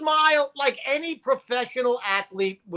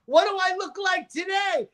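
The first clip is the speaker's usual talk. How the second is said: retold shout, higher; 8 semitones higher than usual. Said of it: "I said".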